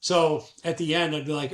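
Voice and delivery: usual speaking voice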